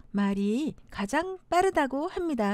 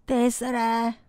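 low, level Jeolla dialect; 전라도 사투리; 5 semitones lower than usual. Shown as a demonstration. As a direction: brush it off like a Jeolla local. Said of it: "전라도". (낮은 전라도 사투리)

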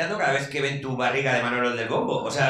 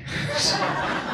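high burst of laughter. Real, not acted. Laugh after pilot bit.

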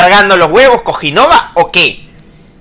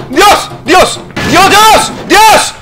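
high, rising yelps; pained shouts; 10 semitones higher, much slower than usual. Real, not acted. Player taking hits, repeated high yelps. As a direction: yelp each time it hurts.

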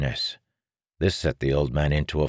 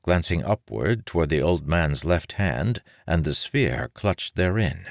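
ordinary delivery

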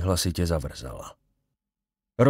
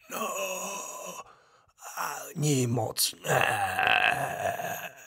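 slow, soft, breathy whisper from the grave; a dead man calling his son, slow and eerie; ghost of the dead father; 8 semitones higher, 5 dB quieter, much slower than usual. A narrator's reading. Ghost answers dryly. (pomalý šeptavý hlas ducha mrtvého otce)

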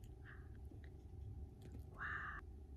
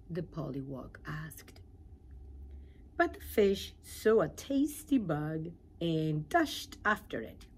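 ordinary delivery, nothing marked

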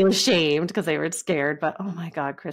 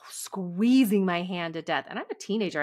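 ordinary talk, nothing marked

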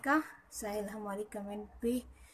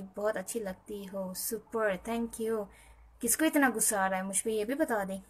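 ordinary delivery

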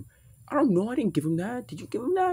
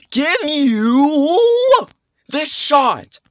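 loud, high insistent shout; loud commanding shout; forceful yell; 10 semitones higher, 11 dB louder, much slower than usual. Acted. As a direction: push through and give the shot. Forcing the flu shot on him.

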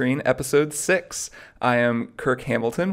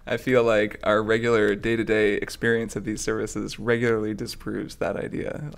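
normal talking voice

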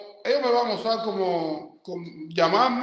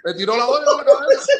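louder and higher, indignant; outraged exclaim; 6 semitones higher, 9 dB louder than usual. Real, not acted. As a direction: blurt out the outrage. Outraged retelling of beaning. (exclamación indignada)

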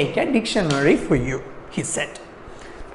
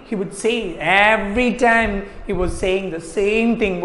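ordinary talk

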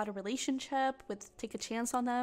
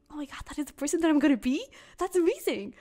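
put-on fast, higher, amazed child's voice; awed little kid; 4 semitones higher, 4 dB louder than usual. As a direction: rush it out like a wide-eyed kid. Little self admiring grown-up you.

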